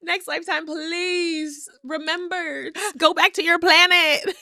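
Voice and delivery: high, loud, dragged-out mock plea; alien lament. Host jokes she doesn't belong on Earth, high mock wail. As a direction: wail it like a stranded alien begging to go home. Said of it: "I'm like".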